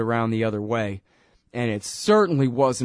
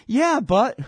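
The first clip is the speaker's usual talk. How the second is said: high, louder whiny protest; mock upset interviewer; 12 semitones higher, 4 dB louder, slower than usual. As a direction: sputter like a flustered host, much higher. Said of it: "He's getting all upset".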